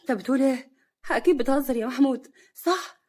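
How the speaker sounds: loud, high-pitched disbelief; shocked. A narrator's reading. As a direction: exclaim in shock, pitch climbing to the end. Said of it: "نوال بصدمة".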